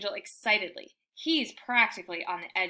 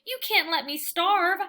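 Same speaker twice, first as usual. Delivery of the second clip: high, louder begging whine; whining plea; 7 semitones higher, 5 dB louder than usual. A narrator's reading. Dog pleading with Hattie for food, high whine. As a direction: beg like a starving pup. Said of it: "I whine".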